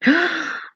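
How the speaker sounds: sharp, high-pitched gasp; real gasp of surprise; stunned gasp. Real, not acted. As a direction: gasp in sudden disbelief. Stunned by news from chat.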